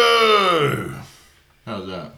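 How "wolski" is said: high, loud ring announcer shout; hype shout. Acted. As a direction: call the name like a boxing ring. Crowd-hype name shout.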